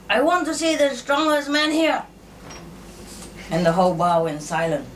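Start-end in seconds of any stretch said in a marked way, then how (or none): 0.1-2.0 higher-pitched mock shout, only a touch louder; retold loud shout. Muscle guy challenging the whole bar, mock shouting. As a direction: call out a challenge, pitched high. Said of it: "shouted very loud".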